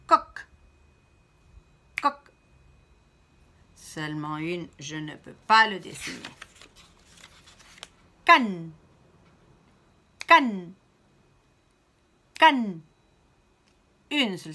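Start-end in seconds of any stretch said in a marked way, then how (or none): 0.1-2.2 Coq lancé haut et très fort, un seul coup; syllabe frappée. Shown as a demonstration. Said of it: "frapper deux fois dans la main". 8.2-12.8 Canne lancé très fort en un seul coup; syllabe frappée. Shown as a demonstration. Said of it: "frapper deux fois dans la main".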